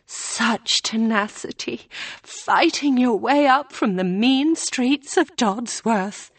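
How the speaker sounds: mocking tease. A narrator's reading. April laughing at Hannah, mock admiring. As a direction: pretend to be impressed. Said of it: "She was laughing".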